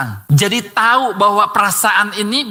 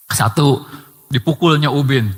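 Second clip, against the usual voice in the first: usual speaking voice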